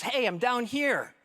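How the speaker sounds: high, drawn-out call from below. Acted. Trapped man to doctor looking around, calling out high. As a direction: call up to be found, stretching the words. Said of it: "the guy yells up at him".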